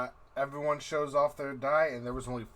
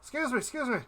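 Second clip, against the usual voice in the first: high-pitched polite call; calling a waitress; 11 semitones higher, faster than usual. Acted. Gortek hailing a passing waitress, calling out. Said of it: "hold up his hand and be like".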